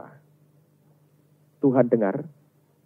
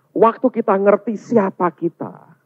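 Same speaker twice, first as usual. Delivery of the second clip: normal talking voice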